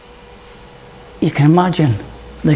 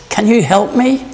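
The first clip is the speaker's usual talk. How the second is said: higher, pleading call for help; 5 semitones higher than usual. Acted. Man hanging over the cliff begging for help, pleading. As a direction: beg for rescue. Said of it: "The man asked".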